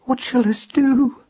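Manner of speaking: high, slow, frightened plea; distressed wife's small voice; frightened whisper. A narrator's reading. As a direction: ask helplessly, high and drawn out. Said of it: "she whispered through chattering teeth".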